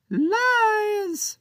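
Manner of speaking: tiny pirate captain rejecting Captain Joe's excuse; arguing tiny pirate. Acted. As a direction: spit back the word like a tiny furious pirate. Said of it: "Captain Big argued".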